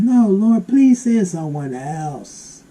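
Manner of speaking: a high, loud protest, slowing on the plea; a high, loud, begging Moses; high pleading Moses. Acted. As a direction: beg God to send someone else. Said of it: "But Moses answered".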